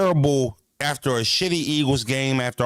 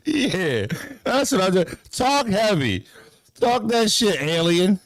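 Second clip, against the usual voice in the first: 1 hyped boast; 7 semitones higher, 3 dB louder, slower than usual. Real, not acted.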